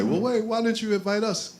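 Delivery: high, put-on complaining voice; mock indignant protest. Left-out alumni protesting to the panel, mock indignant and high. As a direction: play the left-out friends whining at being excluded. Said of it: "they wanted to say".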